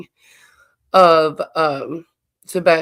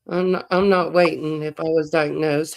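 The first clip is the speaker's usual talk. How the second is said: ordinary delivery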